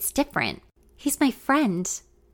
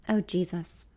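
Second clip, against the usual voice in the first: usual speaking voice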